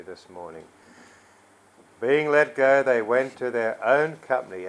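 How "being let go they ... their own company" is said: loud Bible reading, "own company" stressed; stress on key words. Shown as a demonstration. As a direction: repeat the verse, driving home "own company". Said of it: "I'm emphasising the key words for the purpose of".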